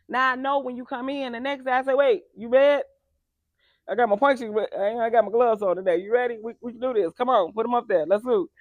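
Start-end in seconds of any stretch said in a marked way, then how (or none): 1.9-8.5 loud, higher, hyped like a sparring partner; playful fight-ready. Retold or imitated. Coach playfully squaring up with a client, loud and hyped. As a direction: egg them on, game for a fight. Playful boxing challenge next day.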